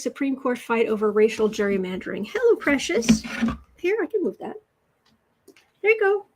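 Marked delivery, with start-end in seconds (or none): 2.3-6.3 much higher, sing-song pet talk; cooing to the cat. Real, not acted. Greeting her cat Precious, high cooing pet voice. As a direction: coo sweetly up high at a cat.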